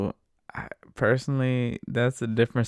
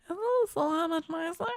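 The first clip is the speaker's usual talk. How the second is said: high-pitched mock lady voice; lady passenger; 13 semitones higher than usual. Lady selling the radio song to partner, high imitated voice. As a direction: imitate her coaxing, pitched way up. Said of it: "then she's just like".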